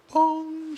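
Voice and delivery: high imitated call-button ding; ping it like the cabin bell; chime noise. Comic imitating the flight attendant call chime.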